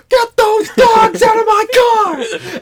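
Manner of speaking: high, loud, slow furious shout; angry manager. Retold or imitated. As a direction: yell at the dogs like an outraged car dealer. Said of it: "he's like".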